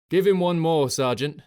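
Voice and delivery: loud call against howling wind; shouting over the gale. A narrator's reading. Officer shouting to his sergeant over the wind, loud. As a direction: yell it over the storm. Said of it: "straining over the gale".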